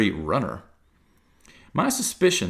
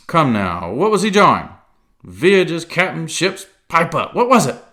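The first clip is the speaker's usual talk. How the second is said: loud, high mocking interrogation; mock him, then press hard; browbeating sneer; 9 semitones higher, 8 dB louder than usual. A narrator's reading. Silver browbeating Morgan, loud and mocking.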